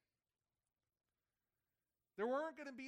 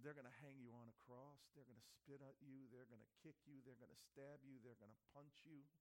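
soft, grim list of the beatings; hushed Father; 6 semitones lower, 15 dB quieter than usual. Retold or imitated. Father describing the cross, hushed and grim. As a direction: let each blow land quietly. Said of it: "He said".

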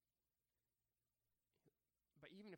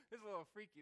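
mock freaked out; 6 semitones higher, 10 dB louder, faster than usual. Peter frightened on water.

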